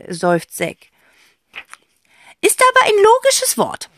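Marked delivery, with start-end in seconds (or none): 2.4-3.9 high, loud indignant aunt voice; objecting aunt. A narrator's reading. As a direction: protest like a quirky aunt. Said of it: "widerspricht Tante Rotze".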